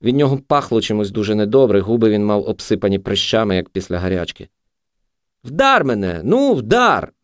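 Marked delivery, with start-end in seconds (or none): 5.4-7.1 high, urgent plea; goading, high-pitched entreaty; almost begging. A narrator's reading. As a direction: beg him to do it. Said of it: "мало не благав він".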